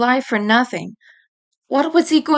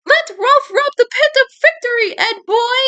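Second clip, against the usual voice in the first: much higher, hammed-up exclaiming kid; Ralph exclaiming; 13 semitones higher than usual. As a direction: crow it out as goofy Ralph. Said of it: "Ralph exclaimed".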